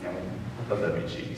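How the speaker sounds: ordinary delivery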